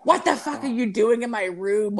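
high startled voice of a woken roommate; a roommate jolting awake, confused; mock startled roommate. Roommate waking to find friend in his room, startled.

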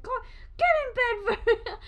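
high, loud mom order; scolding mom. Retold or imitated. Mom sending boys to bed, scolding mom. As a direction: order them to bed, breaking into laughter. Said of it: "she's like".

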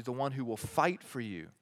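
ordinary talk

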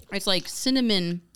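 ordinary delivery

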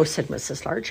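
ordinary delivery

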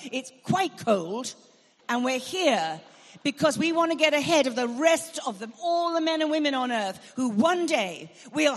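loud, rising sermon cry, pressing each phrase; open-air preacher calling the crowd to worship; preaching at full force. Acted. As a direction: preach it out over a field crowd. Preaching builds in the field.

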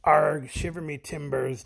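pirate greeting to the podcast host. Acted. Pirate caller hailing Jason in a pirate voice. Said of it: "Your pirate talk is not so good".

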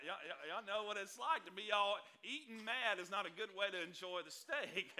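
ordinary talk

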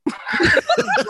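high delighted squeal of a laugh; real high laugh; burst of laughter. Real, not acted. Daughter reacts to mom's accent.

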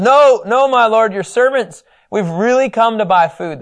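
high, loud anxious protest; frightened brothers plead, high and loud. Retold or imitated. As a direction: beg the lord to believe.

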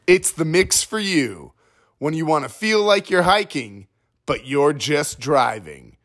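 high, bright parody commercial voice, sing-song; mock ad read. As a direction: sell trail mix like a cheesy radio ad. Mock ad read for Trail Mix.

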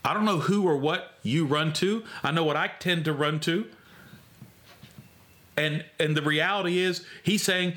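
usual speaking voice

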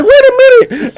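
very high, pleading squeak; squeaky plea. Retold or imitated. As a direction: squeak it like a scared kid. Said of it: "I'm like".

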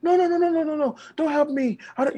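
high-pitched flustered protest; acted insistence on coping alone; refusing help. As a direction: fend off help in a high, rapid stream. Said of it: "some of us, we, we've seen ourselves like that".

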